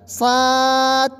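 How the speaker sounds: صاد with thin s and open aa, held; thin-vowelled held صاد; wrong thin reading. Shown as a demonstration. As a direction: say صاد light with an unbounced ending. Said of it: "غلط".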